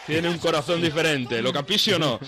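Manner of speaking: loud, high excited commentator cry; excited shout. Retold or imitated. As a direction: belt it out like a goal call.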